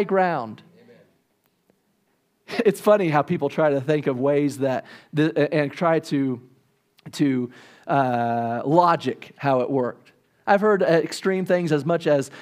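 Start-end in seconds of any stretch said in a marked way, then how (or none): none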